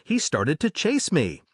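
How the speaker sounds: ordinary delivery, nothing marked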